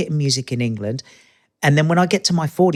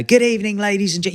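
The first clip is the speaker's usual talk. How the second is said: high, loud TV presenter greeting; upbeat presenter; 8 semitones higher, 3 dB louder than usual. Shown as a demonstration. Showing how presenters open a show, bright and up. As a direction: pitch it up like a host opening a show. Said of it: "you've gotta be up".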